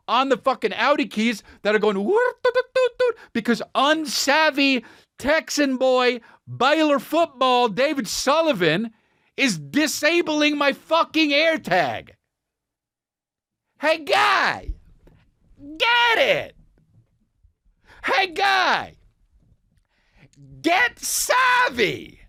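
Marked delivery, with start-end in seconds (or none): 2.0-3.2 high chirpy electronic beeps; imitated beeping. AirTag on the keys going off, high mock beeps. 13.8-16.6 high, drawn-out condescending scold; mock scolding. Mocking the unsavvy friend, high condescending scold. 18.0-22.2 high, drawn-out condescending scold; mock scolding. Mocking the unsavvy friend, high condescending scold.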